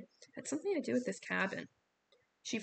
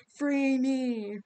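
much louder, echoing Free me; booming echoing command; 4 semitones higher, 9 dB louder than usual. A narrator's reading. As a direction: bellow it like a stone goddess. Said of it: "It spoke in the same echoing voice".

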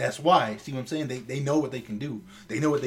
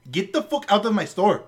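higher, louder mock shout; retold tirade at a thief, raised voice; imitating angry yelling; 7 semitones higher, 6 dB louder than usual. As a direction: throw her out like a furious shopkeeper. Retold angry manager ejecting customer.

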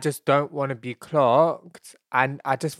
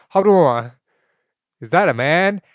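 loud, higher-pitched mock disbelief; alarmed double take; 4 semitones higher, 8 dB louder than usual. Man on the street reacting to her voice, loudly incredulous. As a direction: blurt it out as the penny drops.

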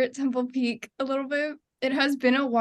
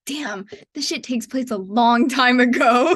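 mock amazed; 6 dB louder than usual. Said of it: "and I was like".

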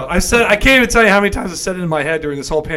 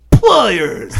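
loud, raised bark of a word; Sid's "Pliers!"; 5 semitones higher, 7 dB louder than usual. Retold or imitated. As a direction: call for the tool like a mad surgeon. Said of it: "when he goes".

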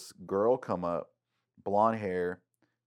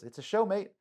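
higher imitation of an Australian lifeguard, matter-of-fact; a matter-of-fact Australian accent, pitched higher; 13 semitones higher than usual. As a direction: say it like an offhand Aussie. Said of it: "Literally, the whole accent".

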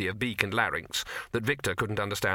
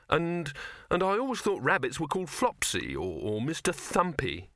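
stumbling, higher-pitched start; flustered gabble; 7 semitones higher than usual. Acted. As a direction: falter nervously over suggested names. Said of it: "Victor gabbled".

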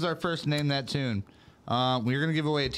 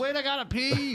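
urgent whiny quote; 12 semitones higher than usual. Retold or imitated. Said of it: "Kyle said".

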